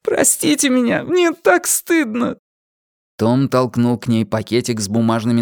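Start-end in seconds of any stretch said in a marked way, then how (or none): 0.0-2.4 crying. A narrator's reading. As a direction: beg forgiveness through tears. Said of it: "Она расплакалась".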